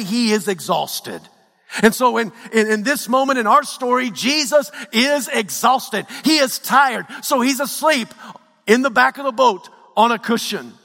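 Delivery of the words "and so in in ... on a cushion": high-pitched, driving sermon delivery; impassioned preaching. Acted. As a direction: build the pain line by line like a fired-up preacher. Preaching at full force.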